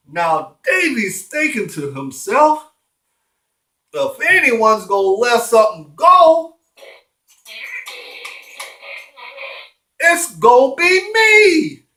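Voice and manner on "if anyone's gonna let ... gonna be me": loud, rising declaration; hammed-up loud resolve; indignant outburst. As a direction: stretch it out high and loud, defiantly. Davy's indignant thought, acted out.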